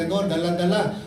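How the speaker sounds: ordinary talk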